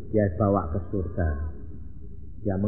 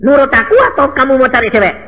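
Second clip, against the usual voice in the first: high loud commanding voice as God; shouted divine ultimatum; voicing god forcefully; 12 semitones higher, 12 dB louder, faster than usual. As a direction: boom out the divine demand. Preacher voicing God's demand.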